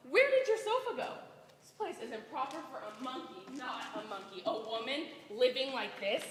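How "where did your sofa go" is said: sharp, high outraged question; indignant surprise. Acted. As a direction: gasp at the missing sofa. Landlady shocked at empty room.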